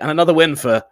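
hammed-up motorsport commentator; British commentator. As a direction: imitate a commentator calling a win, breaking into laughter. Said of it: "every British commentator does it".

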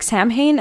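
Samhain with the m and h sounded; spelling pronunciation of Samhain; Samhain as SAM-hain. Shown as a demonstration. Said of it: "I was pronouncing it like that".

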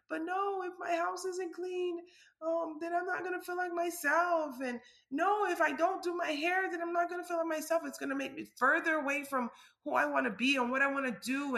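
egotistical self. Retold or imitated. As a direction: play her own stubborn inner voice. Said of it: "egotistical self".

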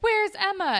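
loud, high mimicked question; urgent demand. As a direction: blurt it out like an urgent character. Retold character demanding to know.

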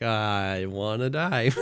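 low, soft, drawn-out groaned words; mock despair. Fed-up tester reacting to know-it-all viewers, mock despair. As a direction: drag it out quietly, utterly defeated.

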